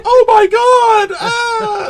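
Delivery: hammed-up horror victims screaming; mock screaming. Mocking the bikini women fleeing the shark, hammed-up shrieks. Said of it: "they're all like".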